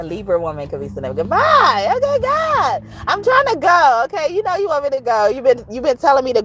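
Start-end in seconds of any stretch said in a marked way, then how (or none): 1.1-6.4 high, loud playful exasperation; a high, loud whine at God; exasperated squeal. Real, not acted. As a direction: protest it, laughing and squeaky.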